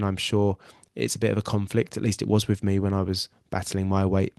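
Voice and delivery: usual speaking voice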